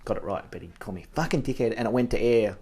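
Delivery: ordinary talk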